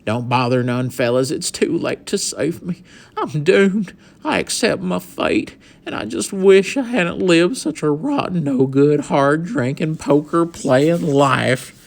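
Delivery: high, loud mournful cowboy lament; cowboy bemoaning his rotten life in a theatrical dirge; disparaging dirge. A narrator's reading. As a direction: wail over his own doom, hammed up. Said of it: "swooped into a disparaging dirge".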